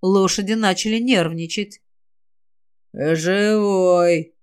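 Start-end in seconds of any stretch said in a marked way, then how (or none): 2.9-4.3 low, drawn-out undead croak; eerie creaking monster voice; quiet creaky rasp. A narrator's reading. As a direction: creak it out slowly like a corpse. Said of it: "тихо проскрипел голос".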